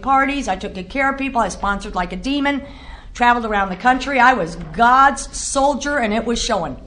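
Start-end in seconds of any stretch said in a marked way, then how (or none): 4.2-6.0 slow proud emphasis. Real, not acted. Proud boast slowed.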